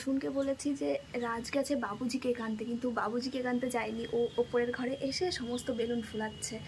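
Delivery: hushed whisper; whispering on the phone. Real, not acted. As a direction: whisper so he won't hear. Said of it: "এত আস্তে কথা বলছি".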